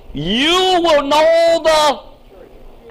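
high, very slow, stretched quote; drawn-out quote. Acted. Preacher quoting Jesus to the congregation, very slow. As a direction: lean on every word, slowly.

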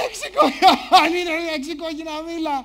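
loud mocking laughter through the words; viewers laughing. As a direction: imitate millions cracking up in disbelief. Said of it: "που παρακολουθούν να γελάνε".

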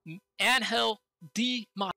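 can't contain the thrill. Real, not acted. Gamer after meeting Angel Di Maria in the game, real thrilled outburst.

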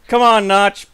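calling out loudly to the sky; loud, hammed-up begging call; loud mock plea. Mock plea to game creator.

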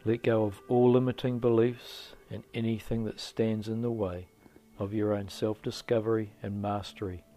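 loud, measured motivational narration; affirmation read. Acted. Guide urging listeners to let go of limiting beliefs, firm affirmation. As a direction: deliver it as a firm, spaced-out affirmation. Reads affirming introduction to meditation.

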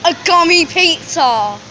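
excited. Real, not acted. Kid spots gummy pizza in shop.

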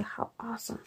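soft whispered delight. Real, not acted. Finding crinkle paper at night, whisper. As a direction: hush it, pleased. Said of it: "I've been a little bit quiet".

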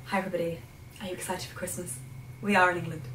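softly spoken exaggerated British hostess; over-the-top British. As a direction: play up a very British English host. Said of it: "so unbelievably British".

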